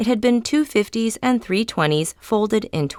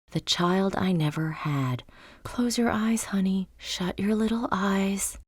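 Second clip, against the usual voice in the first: tender lullaby whisper; 3 semitones higher, 6 dB quieter than usual. A narrator's reading. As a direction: lull a little one to sleep. Imagined mother soothing her child.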